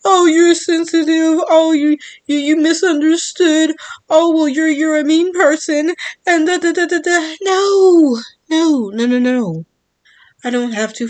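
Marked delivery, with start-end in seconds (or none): 0.0-7.3 sing-song mimicry of a scolding; mocking whiny voice. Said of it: "act like this is kindergarten". 7.4-9.7 emphatic refusal. Real, not acted. Rejects mocked excuses.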